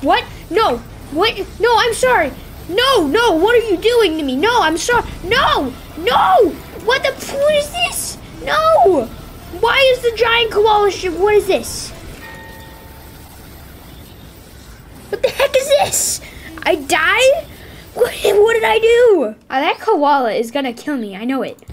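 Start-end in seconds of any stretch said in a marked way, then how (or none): 0.0-9.1 squealing no in high-pitched panic; frantic, high shrieking protests; panicked high yelps. Real, not acted. Gamer shrieking as the koala attacks him, panicked. 9.6-19.3 panicked disbelief. Real, not acted. Player yelling at a giant koala ship, high and bewildered.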